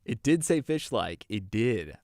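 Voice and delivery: loud and insistent; excited. Real, not acted. Insisting playfully to partner.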